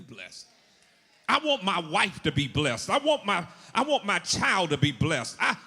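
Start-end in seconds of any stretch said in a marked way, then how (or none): 1.3-5.6 loud, driving sermon climax, pitch climbing; full-force preaching. Real, not acted. Preacher wanting the whole family blessed, impassioned and loud. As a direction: build to a ringing cry for the whole family.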